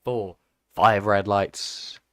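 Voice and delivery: loud live race call, stretching the last count; start-light countdown. Real, not acted. Commentator calling the grid's red lights, loud countdown. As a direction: count the lights in loudly, building anticipation.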